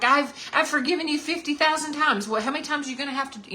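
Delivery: high, fast, exasperated complaint; mock exasperated God. Pretend God complaining to his people, exasperated. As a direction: throw up your hands, fed up. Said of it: "he just doesn't come at us like that".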